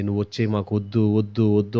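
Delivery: low, soft devil voice refusing; devil's voice. Retold or imitated. Devil talking the youth out of the meeting, low sly refusal. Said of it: "అంటదండి".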